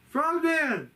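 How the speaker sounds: high, eager shouts of Frogman; excited calling. Retold or imitated. Man with frog plush calling to the crew, excited. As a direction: call out to him like a thrilled fan.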